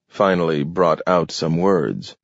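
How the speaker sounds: usual speaking voice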